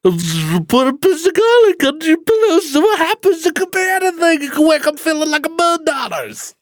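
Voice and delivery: high chirpy clickbait voice; high mocking voice.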